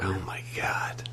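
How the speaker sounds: soft, slow breathy whisper; whispered shock. Real, not acted. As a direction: gasp it under your breath. Shocked at trees catching fire.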